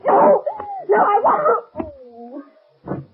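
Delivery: a high cry of pain that breaks, then drags out slowly; pained terrified cry. Acted. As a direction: plead against the pain until you give out. Arm twisted until she faints.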